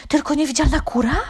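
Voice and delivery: high, eager question; hopeful hen. A narrator's reading. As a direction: call out hoping it's true. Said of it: "zawołała gdaczka z nadzieją w głosie".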